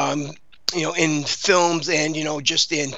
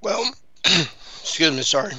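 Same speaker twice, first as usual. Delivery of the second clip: throat clear between sips of coffee; while sipping a drink; slower than usual. Real, not acted. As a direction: talk around a mouthful of coffee. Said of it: "I'm drinking coffee at the same time".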